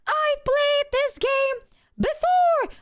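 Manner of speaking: high, slow, loud mocking sing-song; high mock taunt. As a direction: taunt him in a squeaky high voice. Mocking therapist, voice goes high.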